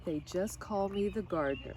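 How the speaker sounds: usual speaking voice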